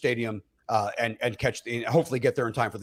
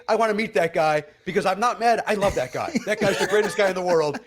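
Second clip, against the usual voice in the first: normal talking voice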